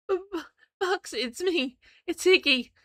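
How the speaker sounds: stammering, high and cracking. Acted. Iggy pleading with Bucks, voice cracking high. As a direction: stutter her name, voice breaking high. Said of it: "my voice cracking".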